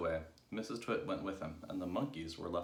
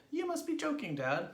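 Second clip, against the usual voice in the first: very high youngsters' disbelief; incredulous cry; 4 dB louder than usual. A narrator's reading. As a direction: protest to dad in a high child voice. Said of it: "they cried".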